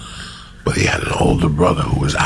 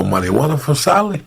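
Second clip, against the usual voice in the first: put-on Cuban accent; 7 semitones higher than usual. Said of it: "And he would go".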